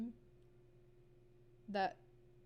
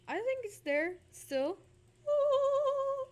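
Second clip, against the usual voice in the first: high voice test; 14 semitones higher, 9 dB louder, slower than usual. Real, not acted. Testing voice high.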